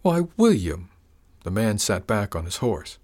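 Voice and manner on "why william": mock hurt. Villain feigns hurt.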